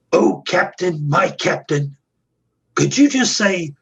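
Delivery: high-pitched pleading puppet voice; puppet character. Retold or imitated. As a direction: plead like an eager little puppet. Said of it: "my puppet character just looked up at him and said".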